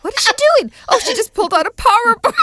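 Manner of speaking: gasp-along running commentary, building to outrage; scandalised onlooker. Acted. Imagining guests spotting Jan's power bar, scandalised.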